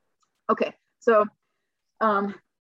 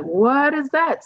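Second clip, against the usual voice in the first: high, slow, drawn-out confused question; bewildered question; 7 semitones higher, 3 dB louder, much slower than usual. Retold or imitated. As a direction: ask it high and slow, baffled. Retold confused reaction to diagnosis.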